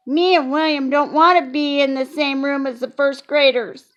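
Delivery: put-on childlike pleading to teacher; lightly voiced little girl; Junie B voice. A narrator's reading.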